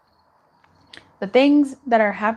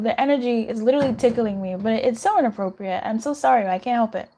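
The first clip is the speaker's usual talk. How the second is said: normal talking voice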